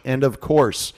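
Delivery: ordinary delivery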